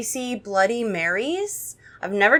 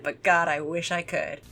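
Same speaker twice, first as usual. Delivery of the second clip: usual speaking voice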